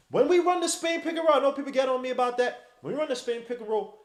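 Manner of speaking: usual speaking voice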